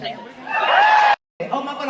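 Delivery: high, drawn out squeal of surprise; suddenly spot someone and gasp; surprised. Real, not acted. Spots hands in crowd.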